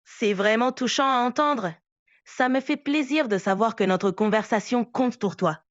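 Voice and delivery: light Québécois French accent; Quebec accent. Real, not acted. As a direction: speak with a mild Quebec lilt. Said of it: "arrêter avec l'accent québécois".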